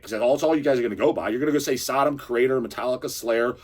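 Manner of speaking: normal talking voice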